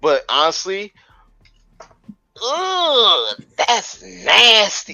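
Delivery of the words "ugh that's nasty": high, slow, grossed-out ugh; disgusted groan. Real, not acted. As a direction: recoil at something nasty. Reacting to a gross moment.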